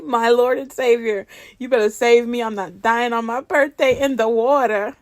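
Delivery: ordinary talk, nothing marked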